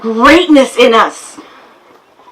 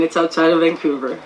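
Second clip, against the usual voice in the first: ordinary delivery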